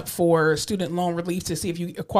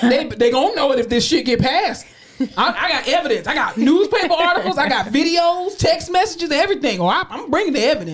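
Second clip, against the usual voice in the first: loud, high, laughing boast; fired up; 7 semitones higher, 4 dB louder than usual. Real, not acted. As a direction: rant it with glee. Worked-up boasting about evidence.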